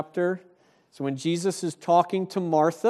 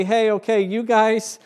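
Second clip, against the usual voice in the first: higher, louder, breezy mock offer; a hammed-up laid-back angel, louder and higher; mock casual angel; 6 semitones higher, 6 dB louder than usual. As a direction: play the angel as lazily easygoing. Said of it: "the angel doesn't say".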